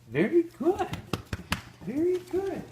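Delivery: very high, sing-song praise to a dog; squeaky dog praise. Real, not acted.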